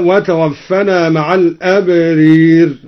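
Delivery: الأبرير with the long ā leaned toward ē; imāla on al-abrār. Shown as a demonstration. Teacher demonstrating imāla on الأبرير, slow recitation. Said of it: "وأمال الأبرار".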